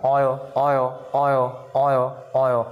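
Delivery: oil repeated with a clear rounded oy; drilled oil. Shown as a demonstration. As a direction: model oil for the class. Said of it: "âm ồ nghe rất rõ đấy các bạn".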